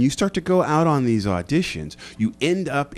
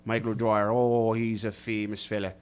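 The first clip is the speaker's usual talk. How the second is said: put-on Irish accent. Guest slips into Irish to joke with the host about Mulligan's, Irish accent. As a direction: play a boozy Irish fella plugging his pub. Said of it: "you just became Irish there".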